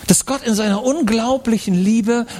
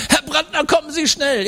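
put-on higher, hurried kids calling at the door; urgent schoolkids; 9 semitones higher than usual. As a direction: schoolmates fetch the father urgently in higher kid voices. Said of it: "Schulkollegen stehen da".

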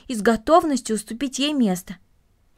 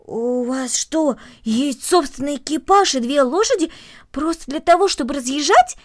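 drawn-out start rising to louder disbelief; amazed; 4 semitones higher, 6 dB louder than usual. Acted. Boy learning the family has its own carriage, amazed. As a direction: ask it in growing amazement. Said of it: "полное изумления".

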